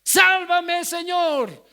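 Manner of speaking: high, loud shout of fear; terrified shout. Acted. Pedro sinking, shouting to the Lord in terror. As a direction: scream for rescue as the water takes you. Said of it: "ahora estaba gritando de miedo".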